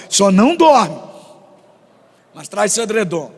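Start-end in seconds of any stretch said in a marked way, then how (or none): 0.1-1.0 só não dorme punched out loud; louder warning. Shown as a demonstration. Pastor warning sleepy church, loud playful command. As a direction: bark it at the sleepy crowd. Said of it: "Fala pra pessoa do seu lado".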